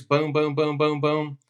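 rapid mouth-made pistol shots; gunfire imitation. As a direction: pop out each boom like a shot.

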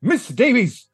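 scolding Tristan. Acted. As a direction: lecture her like an outraged hall monitor. Player voices Tristan scolding the monster.

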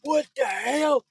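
high-pitched shouted confrontation, mock fury; hammed-up furious shout; outraged parent. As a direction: yell like a parent storming at a stranger. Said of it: "come running out of a tent going".

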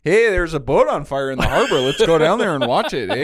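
loud, high, lilting mock Canadian accent; hammed-up Halifax local with a Canadian lilt. Teasing Halifax locals gawking at the fire, mock Halifax accent. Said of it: "Halifax doesn't talk like that".

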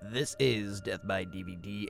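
ordinary talk, nothing marked

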